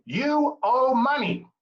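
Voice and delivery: high, loud, slow and pointed YOU; blunt accusation. Shown as a demonstration. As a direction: jab the word You at the caller. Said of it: "gets people excited in a negative way".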